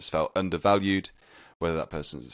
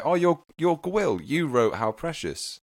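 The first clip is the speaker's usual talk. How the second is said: imagined grateful fan; 6 semitones higher than usual. Retold or imitated. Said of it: "maybe for someone to say".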